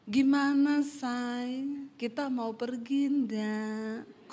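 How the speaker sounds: overdone gentle tone, drawn out eerily; horror-like falling tone. Shown as a demonstration. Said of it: "Kok jatuhnya kayak horor".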